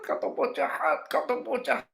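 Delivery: high mock-hysterical wail; imitated hysterics. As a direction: mimic a distraught girl's accusing cry. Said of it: "dia histeris". (tiruan jeritan histeris bernada tinggi)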